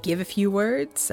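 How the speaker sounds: ordinary talk, nothing marked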